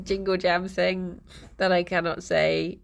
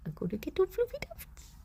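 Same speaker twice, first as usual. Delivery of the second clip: high pet talk; 11 semitones higher, 10 dB quieter than usual. Real, not acted. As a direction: coo it up high at the cat. Same threat, higher pitch.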